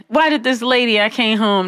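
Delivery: ordinary talk, nothing marked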